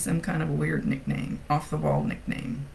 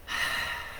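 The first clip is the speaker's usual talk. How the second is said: imitated big weary sigh; 7 dB quieter than usual. Sean giving a big sigh of regret, imitated. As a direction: let out a long heavy sigh. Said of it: "a big sigh".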